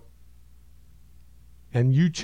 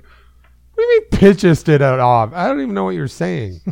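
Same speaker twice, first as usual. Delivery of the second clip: high confused mock voice; 4 semitones higher, 6 dB louder than usual. You to Jesus on the toilet, baffled high squeal. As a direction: squeal it out like you can't follow the old English. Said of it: "You're like".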